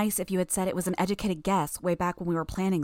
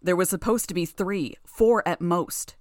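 louder, with an unearthly ring, slowing at the end; unearthly roar; 5 dB louder than usual. Acted. Gru roaring at Tattletale with an unearthly ring. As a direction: roar it, dragging out four at most. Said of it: "Gru roared in his unearthly voice".

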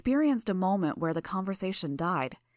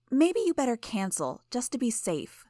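smug teasing; 7 semitones higher than usual. Acted. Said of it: "sounding way too pleased with herself".

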